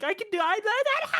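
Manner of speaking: put-on very high stammering child panic; panicking kid. Kid in the meme as the needle nears, shrill stammer. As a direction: stutter in rising terror. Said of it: "he's just like".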